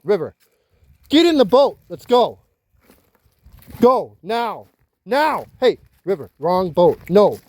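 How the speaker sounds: high, loud commands at the dogs; real exasperated shouting; angry yelling. Real, not acted. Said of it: "I'm mad".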